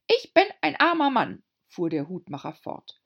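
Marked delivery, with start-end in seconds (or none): 0.1-1.4 timid little hatter. A narrator's reading. Hatter pleads.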